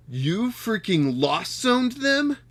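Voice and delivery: can't believe what they did; slow disbelief. Real, not acted. Disbelief at opponent.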